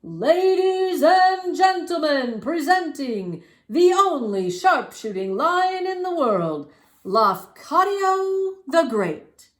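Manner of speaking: high, loud, slow sing-song announcing; ringmaster hollering. A narrator's reading. Ringmaster presenting the sharpshooting lion, loud slow sing-song. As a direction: belt it out like a big-top ringmaster. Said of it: "the ringmaster with the long mustache hollered".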